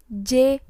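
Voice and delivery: second "J" said as French "zhi"; French letter J. Shown as a demonstration. Teacher showing how J is read, French letter sound. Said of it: "huruf J dibacanya".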